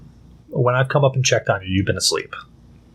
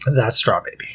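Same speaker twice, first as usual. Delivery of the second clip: whispered; 2 semitones lower than usual. Real, not acted. Whispered comment about the cat.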